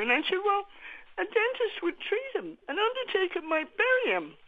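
real grief, pitch climbing high between pauses; halting, high voice of someone too upset to go on. Real, not acted. Grieving mother calls radio about her son's death, upset. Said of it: "I'm too upset".

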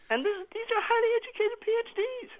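high, hurt protest; real hurt pushing the pitch right up; pained. Real, not acted. Mother recounts colleagues' slur on her research, pained. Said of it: "I'm still in pain".

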